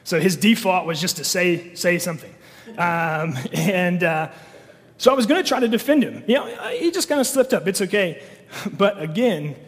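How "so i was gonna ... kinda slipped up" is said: higher and fast, half-joking; sheepish callback. Real, not acted. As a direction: toss it off quickly with a wry grin. Repeats the defence line, sheepish.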